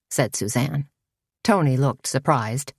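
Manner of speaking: usual speaking voice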